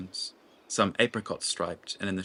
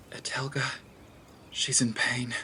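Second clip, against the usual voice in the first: breathy higher whisper of dread; pained whisper; 5 semitones higher than usual. Acted. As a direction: breathe it as if sensing far-off suffering. Nima senses the city's grief.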